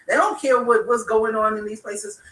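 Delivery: normal talking voice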